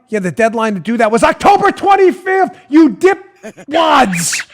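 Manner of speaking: loud, slowing on the insult; mocking shout. Normal person tells off the group, mocking shout. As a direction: yell the deadline at them in disbelief.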